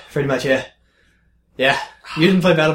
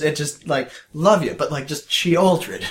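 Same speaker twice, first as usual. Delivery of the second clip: ordinary talk, nothing marked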